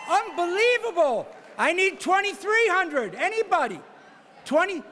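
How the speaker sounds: brisk auctioneer patter pushing for the next bid; auctioneer bid calling. Real, not acted. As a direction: call the bids like a charity auctioneer. Auctioneer running live bidding.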